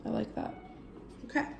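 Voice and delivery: muttering softly to herself; quiet. Real, not acted. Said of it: "I'm gonna be a little bit quiet".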